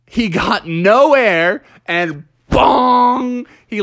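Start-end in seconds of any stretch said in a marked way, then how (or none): none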